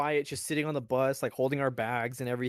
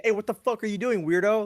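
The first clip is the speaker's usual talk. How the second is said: louder, higher, mocking the sergeant; mock drill sergeant yell; 5 semitones higher, 6 dB louder than usual. Drill sergeant yelling at a recruit, mock loud. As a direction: bark it at the recruit. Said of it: "But now they can be like".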